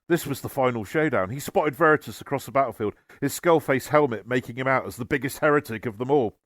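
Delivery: plain reading voice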